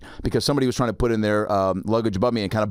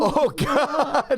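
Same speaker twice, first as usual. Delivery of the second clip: oh god in a much higher voice, laughing; high mock wail. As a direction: squeal it out like a panicked flyer. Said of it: "I'm like".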